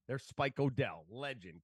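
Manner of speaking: ordinary delivery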